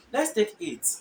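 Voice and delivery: ordinary talk, nothing marked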